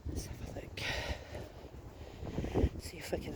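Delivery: hushed whisper among graves. Real, not acted. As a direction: whisper it softly. Quiet peek among graves.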